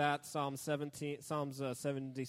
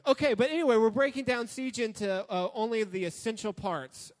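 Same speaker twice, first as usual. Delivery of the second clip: high and loud, pumped up; loud, excited restart; 6 semitones higher, 8 dB louder than usual. Real, not acted. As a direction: burst back in with energy. Restarting the announcement with a burst.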